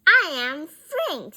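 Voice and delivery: loud, emphatic declaration; loud retort. A narrator's reading. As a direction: announce yourself over the noise. Answers a shout over the bike.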